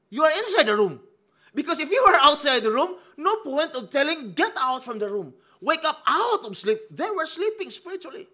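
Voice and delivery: high, loud pulpit preaching; preaching at full force. Acted. Preacher hammering the point to the congregation, loud and high. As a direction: lift the room with a loud, high sermon.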